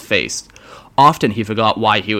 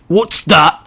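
higher, louder gruff demand; grunting orc; 7 semitones higher, 5 dB louder than usual. Acted. Orc boss pointing at the grots, gruff grunt. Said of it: "he grunted".

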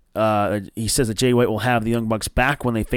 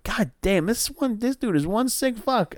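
retold amazed reaction; 11 semitones higher than usual. Reacting to barbed-wire lick.

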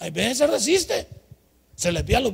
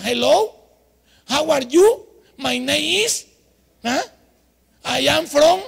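a hint of clumsy beginner English with a Spanish accent, higher; 6 semitones higher, 7 dB louder, slower than usual. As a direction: fumble the phrases like a new immigrant. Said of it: "ni fonética, ni pronunciación". (inglés con acento hispano, torpe)

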